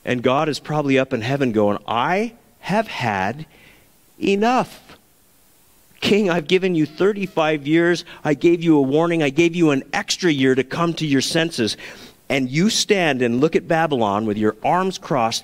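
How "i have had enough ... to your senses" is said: exasperated God. Acted. God fed up with the proud king, exasperated and raised. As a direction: let God lose patience, rising to a near shout.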